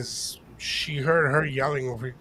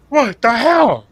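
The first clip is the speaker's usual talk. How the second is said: mock startled outburst; 7 semitones higher, 10 dB louder, much slower than usual. Sudden scare during the movie.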